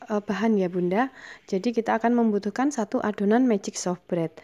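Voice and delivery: normal talking voice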